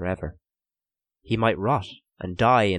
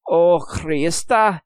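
slow, high, louder groaning gasp; gasped oath; 10 semitones higher, 6 dB louder, much slower than usual. A narrator's reading. Prisoner about to throw up, gasped oath. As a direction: gasp it as nausea takes over. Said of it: "he gasped".

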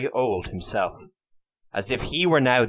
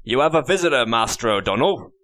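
constable announcing; 5 dB louder than usual. A narrator's reading. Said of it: "announced the constable".

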